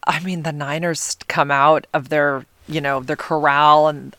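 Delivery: ordinary delivery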